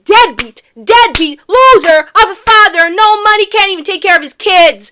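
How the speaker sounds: loud, high-pitched tirade of insults; outraged yelling. Pretend. Recapper berating Hamilton as a bad father, shouted outrage. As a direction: hurl each insult at full volume.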